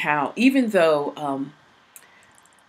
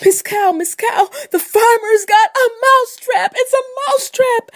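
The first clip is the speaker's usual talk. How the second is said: high, loud, slow wailing plea; panicked mouse; 8 dB louder, slower than usual. Acted. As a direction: wail for help as a desperate mouse. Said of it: "and he said".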